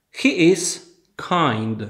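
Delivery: slow, carefully spaced words for a class; slow model sentence. Shown as a demonstration. Teacher to Polish students, slow clear model sentence. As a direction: say it slowly so learners can copy. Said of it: "To powtórzmy jeszcze raz".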